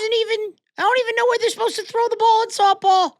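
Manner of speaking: very high mock voice of the dad. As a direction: squeak out a ridiculous version of the dad. Mocking the softball dad.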